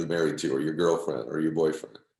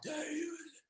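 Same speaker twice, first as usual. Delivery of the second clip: imitating a sick father's airless, strained question; breathy, barely voiced call to his wife; dying father's strained voice; 15 dB quieter than usual. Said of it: "he's saying to my mom".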